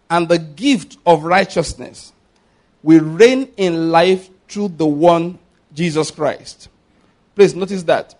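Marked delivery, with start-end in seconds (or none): 0.1-6.7 slow, ringing Bible reading; scripture proclamation. Acted. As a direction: draw out every phrase with weight. Reads scripture aloud to congregation.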